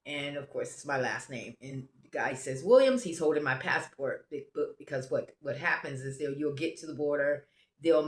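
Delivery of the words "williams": loud, raised call of a surname. Retold or imitated. Border guard holding a passport on the bus, calling out. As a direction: summon a passenger by surname.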